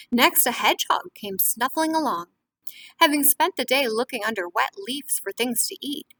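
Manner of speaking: lively storybook reading to a class; reading to children. A narrator's reading. Teacher reading a picture book to the class, lively. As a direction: read it warmly to young listeners.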